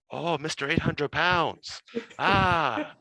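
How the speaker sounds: a lightly put-on Serbian accent, slow and amused; mock Serbian guard. Serbian guard laughing, nicknaming Hamish in a Serbian accent. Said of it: "with a real heavy, Serbian accent".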